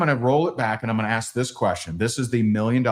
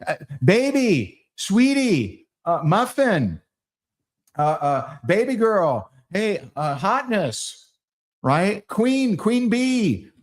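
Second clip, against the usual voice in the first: doting husband; 7 semitones higher, slower than usual. Pretend. Calling wife pet names.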